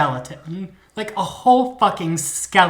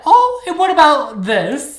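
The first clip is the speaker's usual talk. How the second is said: high, loud theatrical challenge; spring the damning evidence on him; triumphant gotcha; 11 semitones higher, 8 dB louder than usual. Retold or imitated. Detective confronts her suspect, triumphant gotcha.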